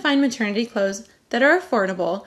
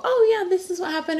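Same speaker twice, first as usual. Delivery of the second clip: bright and sure, pitched up; confident recall; 9 semitones higher than usual. Pretend. Mom telling people about the pregnancy, bright and sure. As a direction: tell it like you remember every detail. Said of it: "Pregnancy brain is a real thing".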